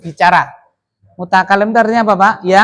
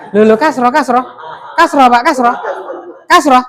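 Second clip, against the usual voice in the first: laughing answer; 5 semitones higher than usual. Real, not acted. Class laughing over answer.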